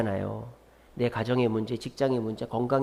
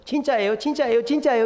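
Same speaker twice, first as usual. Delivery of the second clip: higher, insistent nagging that climbs each time; pestering; 6 semitones higher than usual. Retold or imitated. Believer pestering God with questions, rising insistent nagging. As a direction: badger them, asking over and over.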